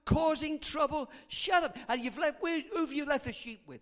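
high, loud angry scolding; furious. Acted. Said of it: "He gets furious with David".

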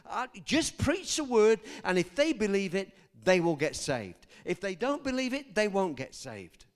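insistent preacher's cadence; preaching at full force. Acted. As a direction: drive the promise home from the pulpit.

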